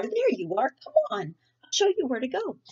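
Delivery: high, gushing welcome. Acted. Principal greeting Sarah at the car, gushing and high. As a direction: swoop up, overflowing with enthusiasm. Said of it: "Missus Burton gushed".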